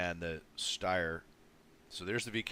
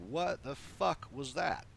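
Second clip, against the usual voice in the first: stunned outrage; 7 semitones higher, slower than usual. Retold or imitated. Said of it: "And I was like".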